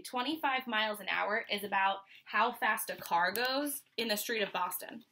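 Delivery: ordinary delivery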